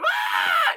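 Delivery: very high-pitched mock scream; imitated scream. Woman finds a guy outside the tent, imitated scream. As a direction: shriek "ah" as if startled by an intruder. Acting out character's scream at intruder.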